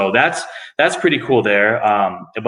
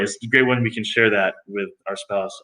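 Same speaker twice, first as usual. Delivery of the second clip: ordinary talk, nothing marked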